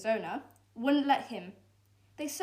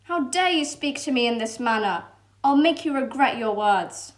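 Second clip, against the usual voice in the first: louder, a touch of a villainous fox; menacing threat; 2 semitones higher, 8 dB louder than usual. Acted. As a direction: threaten them coldly. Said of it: "The fox scowled".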